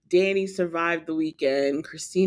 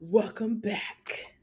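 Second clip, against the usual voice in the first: dark lord; 3 semitones higher, slower than usual. Acted. Spooky podcast welcome, Halloween episode.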